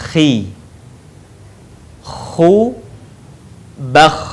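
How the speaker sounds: "Khi", "Khu", "Bakh" singly with a long throat rasp; snoring KH. Shown as a demonstration. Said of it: "seperti orang yang sedang mendengkur". ("Khi", "Khu", "Bakh" seperti mendengkur)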